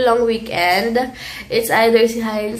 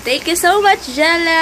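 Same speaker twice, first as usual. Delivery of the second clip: excited thanks; 7 semitones higher, 4 dB louder than usual. Real, not acted.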